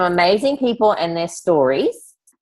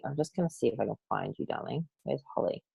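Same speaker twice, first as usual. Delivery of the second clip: much softer, quick half-to-herself murmur; murmured aside; 3 semitones lower, 14 dB quieter, faster than usual. Real, not acted. Host searching the screen for the next speaker, murmured aside. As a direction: mutter it while hunting for someone.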